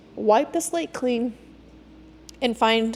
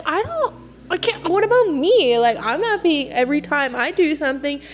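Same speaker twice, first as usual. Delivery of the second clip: exasperated outburst; 5 semitones higher than usual. Retold or imitated. Complaining to her boyfriend, exasperated and higher. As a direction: burst out, fed up. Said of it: "And I was like".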